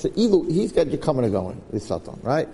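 ordinary delivery

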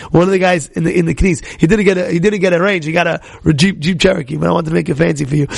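ordinary talk, nothing marked

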